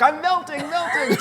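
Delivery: high, hammed-up wicked witch wail; dramatic melting witch. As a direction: ham up the witch melting away. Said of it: "She was the most dramatic".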